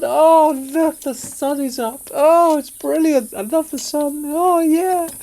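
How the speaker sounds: high, loud, delighted sunflower voice; bright loud cheery voice. Shown as a demonstration. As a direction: greet the sun like a giddy flower. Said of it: "Can I do my impression of a sunflower".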